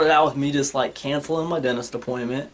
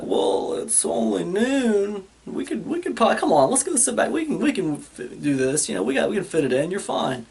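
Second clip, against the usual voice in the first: higher, lightly voiced coaxing dentist; 6 semitones higher than usual. Dentist talking the nervous patient back into the chair, higher and coaxing. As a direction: coax him back into the chair in a higher mock voice. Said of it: "And she's like".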